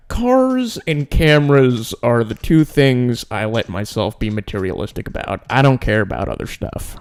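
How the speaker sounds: normal talking voice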